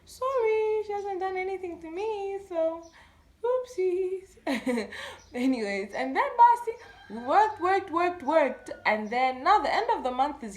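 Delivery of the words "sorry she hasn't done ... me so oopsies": high, light and falsely sorry; sheepish mock apology. Brushing off the lady about her aunt, mock-sorry and high. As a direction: act innocently apologetic, pitched well up. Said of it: "And I'm just like".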